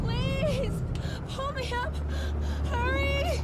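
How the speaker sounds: shrieking, terrified pleas, high and loud; desperate screams for help. Acted. Dangling woman begs to be pulled up, terrified screaming. Hanging over edge, begging rescue.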